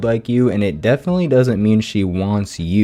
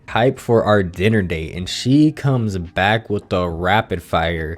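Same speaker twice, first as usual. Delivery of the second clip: ordinary delivery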